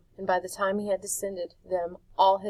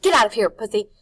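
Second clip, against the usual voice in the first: loud, spat-out snarl; 3 semitones higher, 12 dB louder, faster than usual. A narrator's reading. Rictus spits at the cat to get out, loud and snarling. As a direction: spit the words at the cat. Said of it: "he spat".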